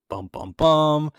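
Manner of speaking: slow, high-pitched bum-bum-bum; hammed-up cliffhanger sound; mock suspense sting. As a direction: drum out a playful plot-twist sting. Mock suspense sting at plot twist.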